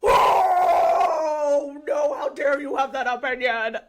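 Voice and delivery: hammed-up outraged wail; mock indignation. Host mocking people who can't bear another opinion, mock-outraged shout. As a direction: imitate an offended commenter, shouting in mock horror. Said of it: "I'm not like".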